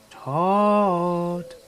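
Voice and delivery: high, loud, long-drawn calls; eerie calling voice. Retold or imitated. Unseen voice searching for Todd, calling out high. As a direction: holler far into the woods, stretching the vowels. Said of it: "we heard the same voice".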